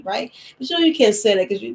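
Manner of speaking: ordinary delivery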